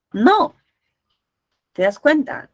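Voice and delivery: usual speaking voice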